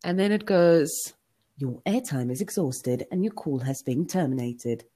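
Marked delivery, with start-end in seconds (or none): 1.6-4.8 low, even recorded-operator voice; automated phone message. Retold or imitated. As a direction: announce it like a phone system recording. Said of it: "So my voicemail".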